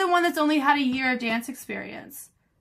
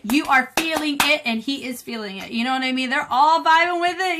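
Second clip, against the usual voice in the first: usual speaking voice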